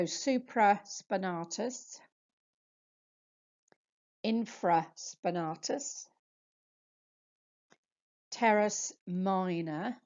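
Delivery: slow, spaced-out list for learners to repeat; measured dictation with long gaps between muscle names; slow pronunciation demo. Shown as a demonstration. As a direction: say each term slowly, pausing after it. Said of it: "say them slowly with a space afterwards".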